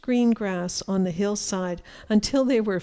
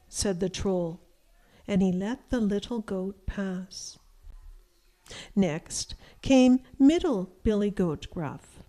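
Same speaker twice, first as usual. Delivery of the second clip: ordinary delivery, nothing marked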